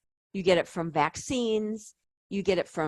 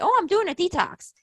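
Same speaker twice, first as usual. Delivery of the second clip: much higher, mock-chirpy client quote; imitating naive clients; 11 semitones higher, 4 dB louder than usual. Client proudly telling the coach about a detox, high mocking imitation. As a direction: put on a high voice to mimic someone. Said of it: "when people come to me and say".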